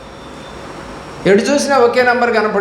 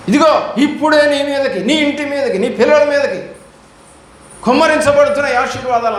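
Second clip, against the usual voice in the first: mock pastor proclamation; 6 semitones higher than usual. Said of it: "అనేవాడంట".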